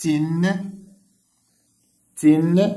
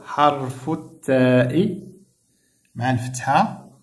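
slow teaching voice; slower than usual. Shown as a demonstration. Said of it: "تبعوا معايا مزيان".

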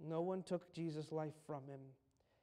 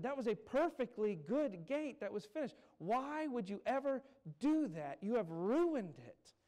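indignant protest; 7 semitones higher, 7 dB louder than usual. Retold or imitated. Carpenter protests destroyed gate.

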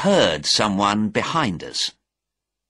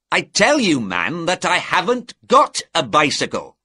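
higher, louder, exasperated denial; irritated insistence; 7 semitones higher, 4 dB louder than usual. A narrator's reading. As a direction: insist as if not believed. Innkeeper insists on his repeated denial.

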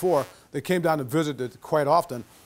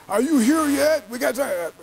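nagging callers; 9 semitones higher, 4 dB louder, slower than usual. Retold or imitated.

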